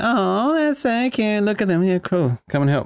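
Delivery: high, gushing mock coo of strangers; cooing onlookers. Bystanders cooing over the kids, high mock gush.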